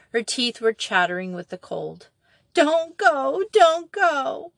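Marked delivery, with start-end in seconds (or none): 2.5-4.5 put-on high wailing child; piteous howl. A narrator's reading. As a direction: wail it from the pit. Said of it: "in a piteous howl".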